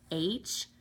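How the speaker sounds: usual speaking voice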